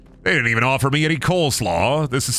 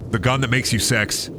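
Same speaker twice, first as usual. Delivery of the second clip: ordinary delivery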